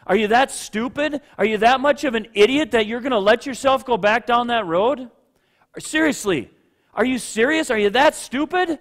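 loud, high, incredulous tirade; acted furious scolding, shouted in disbelief; harsh berating pastor. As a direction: blow up at someone who came for help. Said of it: "Just hearing me say it like this".

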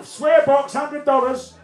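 mock-stern shout. Real, not acted. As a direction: bellow the penalty at yourself. Fines himself for swearing.

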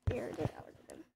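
fast hushed whisper; quick whisper. Real, not acted. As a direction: rush it out in a whisper. Secret aside about scaring.

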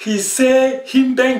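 slow, careful "hissé himbenge" with each syllable spaced out; teacher modelling "hissé himbenge" slowly; Bassa word demo. Shown as a demonstration. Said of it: "on va dire".

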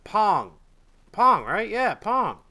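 loud, delighted naming; real nostalgic excitement; excited recognition. Real, not acted. Recognizes childhood game.

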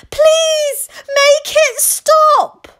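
high, loud, slow wailing plea; desperate pleading. Acted. Mother wailing at Rose to stop the pot. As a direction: beg her to end it. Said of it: "cried her mother".

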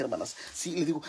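ordinary delivery

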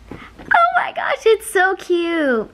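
high slow gushing squeal; excited. Real, not acted. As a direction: swoon over something adorable. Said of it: "I'm so excited".

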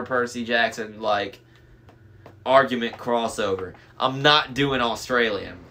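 usual speaking voice

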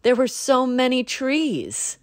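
usual speaking voice

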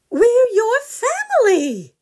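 high, loud exasperated cry; shouted. Acted. Mother bear shouting at forgetful husband, high and loud. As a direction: shout the obvious at him. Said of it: "shouted Missus Forgetful".

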